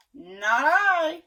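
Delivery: loud pig voice; pig refusing. Acted. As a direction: grunt the no. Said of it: "said the pig".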